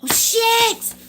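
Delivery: high, loud, slow delighted outburst; real thrilled exclamation; excited surprise. Real, not acted. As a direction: shout for joy at the card that turned up. A card she hoped for appears.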